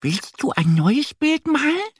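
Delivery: very high, squeaky duck question; little duck asking. A narrator's reading. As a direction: invite a friend in a high duck voice. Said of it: "fragt sie".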